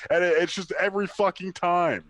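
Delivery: usual speaking voice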